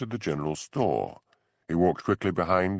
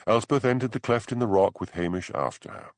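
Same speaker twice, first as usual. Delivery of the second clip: usual speaking voice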